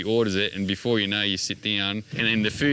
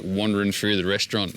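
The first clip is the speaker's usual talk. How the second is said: ordinary delivery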